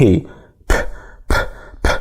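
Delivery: "ب" as a voiceless p with forceful air; strong P puff. Shown as a demonstration. Teacher showing the hard airflow, "ب" as a puffed p. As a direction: pop each "ب" from closed lips, air only. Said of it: "في هوا جامد كده بيطلع".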